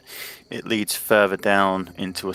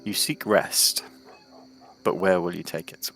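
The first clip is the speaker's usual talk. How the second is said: storytelling narration. Acted. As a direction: paint the scene calmly for the players. GM sets the scene.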